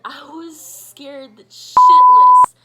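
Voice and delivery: a loud cry of fright; scared yell. Real, not acted. Telling friends about the scare, loud frightened yell. Retelling waking up terrified.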